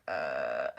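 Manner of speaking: very low drugged uh; loopy medicated grunt; dazed groan. Retold or imitated. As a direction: drop to a dull groan. Confused on medicine.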